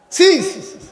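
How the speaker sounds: high-pitched cry of shame, dropping away; scandalized rebuke; disgusted shaming. Real, not acted. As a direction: spit out repeated tuts of disapproval. Preacher cries shame.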